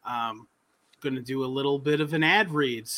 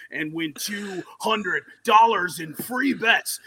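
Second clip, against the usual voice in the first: wrestling announcer voice. Pretend. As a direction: hype it like a wrestling ring announcer. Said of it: "a wrestling announcer's voice this time".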